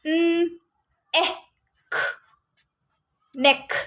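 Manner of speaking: न, एह, ख each said apart, then blended into नेक; sounding out a word. Shown as a demonstration. Teacher showing parents how to sound out नेक. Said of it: "इसी तरह से".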